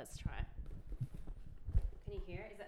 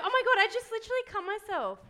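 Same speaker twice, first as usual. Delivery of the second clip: high, fast shocked cry; startled yelp; 12 semitones higher, 5 dB louder, faster than usual. Real, not acted. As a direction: blurt it out in alarm. Cuts herself opening box.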